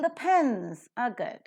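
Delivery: slow, with pens and good lifted; stress on pens and good. Shown as a demonstration. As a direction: pens and good up top, the rest weak. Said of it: "you could listen to pens and good at the top level".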